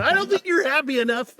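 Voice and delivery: high, creepy clown voice; silly clown. Pretend. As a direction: needle them like a manic clown. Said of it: "I was trying to sound like the guy".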